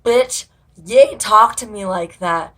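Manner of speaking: slow, loud, mocking sass; loud, slow, hammed-up attitude; mock angry customer. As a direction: imitate the drunk woman snapping back. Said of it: "she's like".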